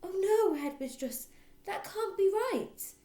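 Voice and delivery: high, shocked protest; young teacher crying out in protest; dismayed cry. Acted. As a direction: gasp out the objection. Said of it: "Miss Honey cried".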